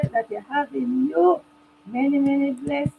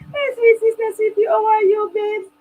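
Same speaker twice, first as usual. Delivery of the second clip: tearful greeting; 8 semitones higher, 7 dB louder than usual. Real, not acted. Emotional greeting of viewer.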